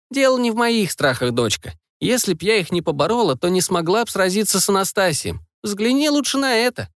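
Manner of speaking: a hint of a wise old witch; old woman voice. Grandmother voiced in story.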